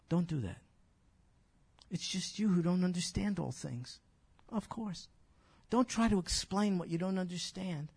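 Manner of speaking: ordinary talk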